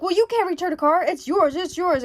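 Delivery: mock pushy salesman refusing the return; pushy insistence. Dealer forcing the car on her.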